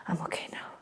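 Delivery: breathless whisper after mock choking; recovering from choking. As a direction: whisper it weakly, just recovered.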